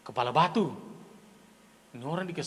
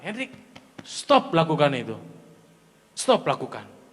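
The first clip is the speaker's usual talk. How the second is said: firm self-command; 6 semitones lower, 3 dB quieter than usual. Shown as a demonstration. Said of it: "Tegas dengan diri sendiri".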